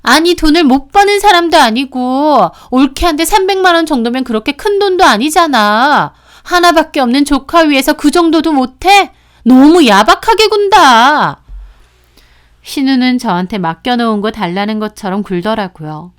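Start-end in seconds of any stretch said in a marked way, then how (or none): 0.0-11.4 indignant, pushy sister-in-law; 못마땅한 시누이. Acted. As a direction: complain higher and louder, as if owed the money. Sister-in-law pressing after refusal. (높은 목소리로 따지는 시누이)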